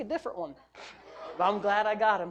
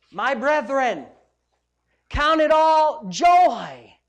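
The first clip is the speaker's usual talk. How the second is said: very slow, louder, drawn-out joy; preaching scripture; 4 semitones higher, 9 dB louder, much slower than usual. Acted. Pastor reading James to the church, proclaiming. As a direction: proclaim the verse like a herald.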